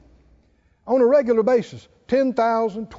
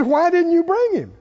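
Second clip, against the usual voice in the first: indignant Pharisee; 6 semitones higher than usual. Retold or imitated. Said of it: "And they said".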